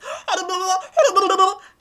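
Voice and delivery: loud, high gibbering goat imitation; frantic wordless goat babble; babbling goat noise. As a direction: gabble nonsense like a manic goat. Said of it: "it's the goat that goes".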